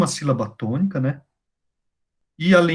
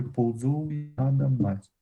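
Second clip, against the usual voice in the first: "pousou e nada mais" slowed and softer, syllable by syllable; teacher recounts the last verse quietly and slowly; slow, quieter scansion; 8 dB quieter than usual. Shown as a demonstration. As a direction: count it again slowly under your breath. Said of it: "Será que é sete".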